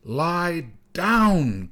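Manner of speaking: high, drawn-out command; crying out. A narrator's reading. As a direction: call it out over the wind. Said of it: "cried the snow boy".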